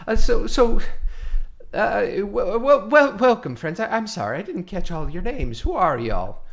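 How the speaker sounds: loud, stammering welcome; nervous orc cook stuttering a greeting; stammering orc host. Acted. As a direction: trip over the words, then greet them loudly.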